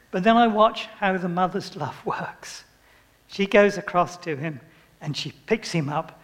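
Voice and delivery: ordinary delivery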